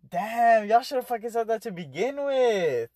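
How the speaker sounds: half-laughing, retold annoyance at friends; exasperated outburst.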